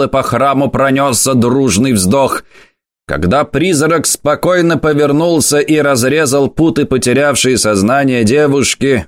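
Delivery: ordinary delivery, nothing marked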